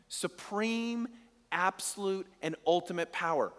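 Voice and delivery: "Supreme, absolute, and ultimate power" spelled out word by word; preacher hammering home God's power, emphatic; emphatic repeat. Shown as a demonstration. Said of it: "repeated with emphasis".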